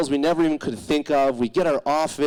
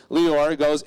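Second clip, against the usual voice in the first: ordinary delivery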